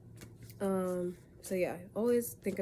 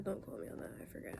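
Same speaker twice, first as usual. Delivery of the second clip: whispered aside; 10 dB quieter, faster than usual. Real, not acted. Aside after unsure astrology claim.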